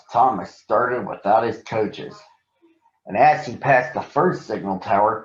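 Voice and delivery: ordinary delivery, nothing marked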